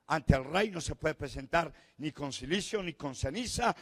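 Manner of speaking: normal talking voice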